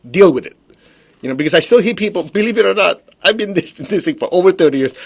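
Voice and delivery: ordinary delivery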